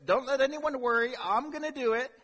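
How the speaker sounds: put-on a boy bursting to volunteer; shepherd boy eagerly volunteering to King Saul, loud and cocky; eager boast. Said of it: "and he's like".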